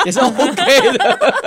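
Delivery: slow laughing finish. Real, not acted. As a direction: laugh through the words. Laughs while saying it.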